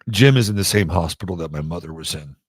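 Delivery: ordinary delivery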